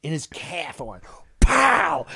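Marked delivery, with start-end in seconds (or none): 1.4-2.0 high, explosive "pow"; kick sound. Retold or imitated. Storyteller kicking the cheater's calf, imitated impact. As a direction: punch it out as an impact noise.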